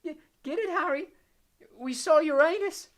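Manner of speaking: high loud giggle, slow; giggling dazed Ron. A narrator's reading. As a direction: giggle through it woozily. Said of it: "still giggling feebly".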